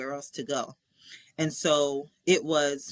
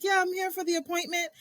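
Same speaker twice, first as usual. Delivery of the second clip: eager self; 12 semitones higher than usual. Retold or imitated. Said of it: "I'm like".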